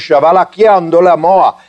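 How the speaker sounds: mock speaking in tongues. Shown as a demonstration. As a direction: rattle off invented tongue-speech without a pause. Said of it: "If I were to stand up here tonight and go".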